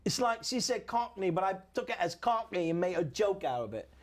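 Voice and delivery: put-on swooping comic English accent; Cockney-ish accent. Comedian defends the joke to co-host, Cockney accent. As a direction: play a chatty mock-Cockney comic. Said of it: "It's Cockney-ish".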